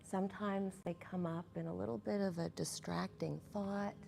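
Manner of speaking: calm, unhurried meditation voice with long pauses; guided meditation. Acted. Meditation leader guiding the group into their breath, calm and measured. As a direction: ease them gently into each breath, leaving space between phrases.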